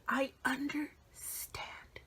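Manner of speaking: slow, breathy whispered reassurance. Retold or imitated. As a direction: whisper it slowly, almost to yourself. Retelling comforting a sorry fan.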